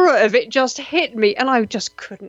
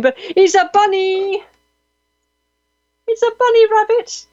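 much higher, excited cry over a bunny; delighted squeal; 10 semitones higher than usual. Real, not acted. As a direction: squeal with delight at the cute picture. Sees bunny photos from listener.

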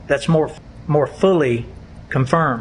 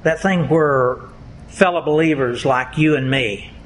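ordinary delivery

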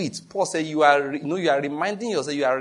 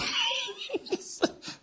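breathy laugh; 8 dB quieter than usual. Real, not acted. Laughing at own boast.

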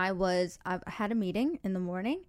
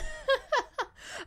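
high laugh. Real, not acted. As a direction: burst of high-pitched laughter. Host laughs at reply.

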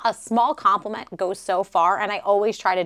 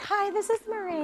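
high, soft phone-greeting voice; sweet receptionist answering a call; customer service voice; 13 semitones higher, 6 dB quieter than usual. Retold or imitated. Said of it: "her customer service voice".

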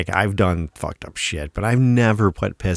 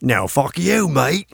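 higher-pitched rival Aussie-or-Kiwi accent, badly faked; second Antipodean accent; 6 semitones higher, slower than usual. The other mate cussing back, in an attempted Antipodean accent. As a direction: answer back in another put-on Antipodean accent. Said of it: "See the difference there?".

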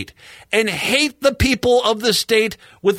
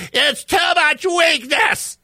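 high, slow hammed-up cry at the snow; a mock shout of outrage at a pile of snow; mock yell at snowbanks; 10 semitones higher than usual. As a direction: pretend to bellow at a snowbank. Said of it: "do you go out yell at snowbanks?".

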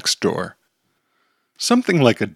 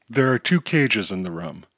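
ordinary delivery

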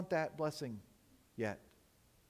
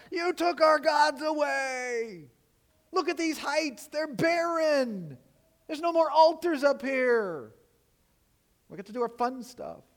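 much higher, slow, loud mocking whine of protest; mock protest shout; 9 semitones higher, 4 dB louder than usual. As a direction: ham up a sulky crowd complaining their gods were taken. People protesting Josiah's reforms, voiced.

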